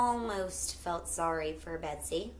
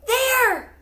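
high sudden shout of alarm; excited shout; 10 dB louder than usual. Acted. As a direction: shout it, pointing at the wolf. Betsy points, spots the wolf.

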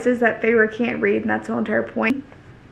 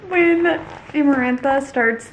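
ordinary delivery

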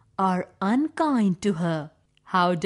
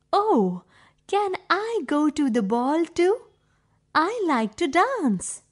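higher, hopeful pleading; eager Cinderella; 5 semitones higher than usual. Acted. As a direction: ask sweetly, hoping to go dancing.

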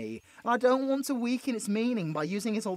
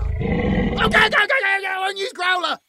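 much higher, louder frantic okays, faster at the end; panicked backpedal; 11 semitones higher, 10 dB louder than usual. Acted. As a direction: retreat in a flustered rush. Backpedaling from an angry werewolf.

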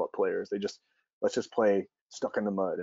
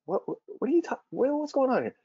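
high, startled what-what-what; startled dad. Retold or imitated. Dad startled by his crying son, high and alarmed.

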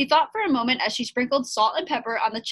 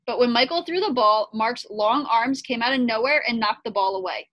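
plain reading voice

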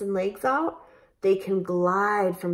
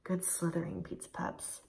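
whisper; 14 dB quieter than usual. Real, not acted. Whispered praise after snake.

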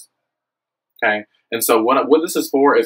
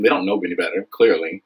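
ordinary delivery

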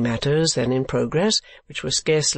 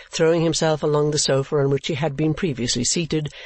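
ordinary delivery

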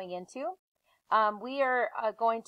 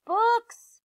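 very high, loud delighted shout; excited shout; 13 semitones higher, 7 dB louder than usual. Acted. Annie shouting down from the treehouse window, delighted shout. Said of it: "she shouted".

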